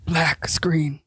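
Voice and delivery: whispered, voiceless interjection; hushed whisper. Real, not acted. Co-host naming the show's twist ending, hushed whisper. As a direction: drop to a dramatic whisper.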